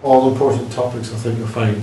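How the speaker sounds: usual speaking voice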